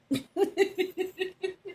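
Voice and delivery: soft breathy giggle; real laugh. Real, not acted. Laughing at her own admission to viewers, soft giggle. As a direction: let a quiet laugh slip out.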